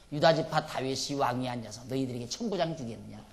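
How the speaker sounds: ordinary delivery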